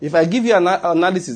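usual speaking voice

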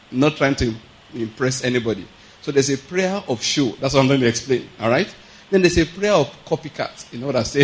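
usual speaking voice

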